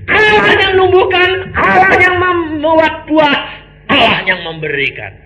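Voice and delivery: high, loud proclamation, slowing on the repeats; preaching climax. Acted.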